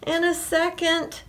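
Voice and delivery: high-pitched plea from bed; pleading farmer. A narrator's reading. Farmer bargaining to stay in bed, pleading.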